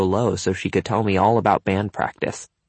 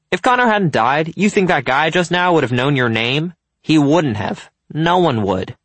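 higher, louder, pressing the point; pointed, argumentative friend; 7 semitones higher, 5 dB louder than usual. A narrator's reading. Jared pressing his friend about being almost popular, emphatic. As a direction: argue it emphatically. Narrator voices Jared arguing.

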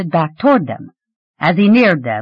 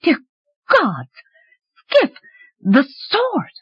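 shocked; 10 semitones higher, much slower than usual. A narrator's reading. Shocked, thinking through mud.

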